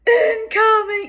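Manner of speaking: high, loud, drawn-out holler; lookout's long high call; calling out warning. Retold or imitated.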